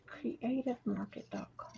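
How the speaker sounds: voiceless whisper under her breath. Real, not acted. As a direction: breathe it out, barely there. Reading a website name aside.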